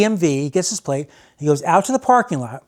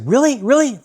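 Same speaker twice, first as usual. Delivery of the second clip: eager disbelief; 8 semitones higher than usual. Retold or imitated. Stranger believes the prank.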